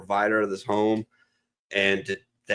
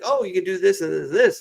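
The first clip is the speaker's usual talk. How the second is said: chirpy bar advice; 10 semitones higher, 3 dB louder than usual. Retold or imitated. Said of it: "and they're like".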